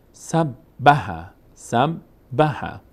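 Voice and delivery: سب، به very slowly, b said twice; slow syllables. Shown as a demonstration. Teacher splits سب، به slowly for learners.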